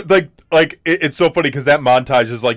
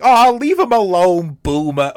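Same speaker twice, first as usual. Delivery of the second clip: put-on high, slow, loud girlish plea; Harley Quinn; 8 semitones higher, 4 dB louder than usual. Cohost voices Harley defending Boomer, high and slow. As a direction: play a teasing Harley sticking up for him.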